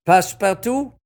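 loud, high, questioning name call; ringing clerk's query; court roll call. A narrator's reading. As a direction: call out the name, rising at the end. Clerk calls out names.

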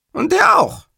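very high, loud reply; hapless caller Cattarella. Acted. Cattarella answers about Augello, very high and loud. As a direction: pipe it up earnestly.

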